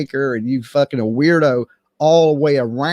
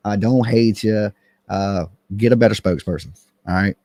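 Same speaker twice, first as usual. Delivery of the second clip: normal talking voice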